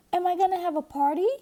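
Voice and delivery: high, fast and loud, bursting with glee; a little girl who can't wait; squealing with excitement; excited cry. A narrator's reading. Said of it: "cried Sister".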